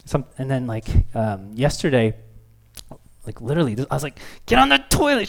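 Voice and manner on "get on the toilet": higher, louder order; exasperated yell. Retold or imitated. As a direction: bark it at a toddler. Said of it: "kinda yelling at her".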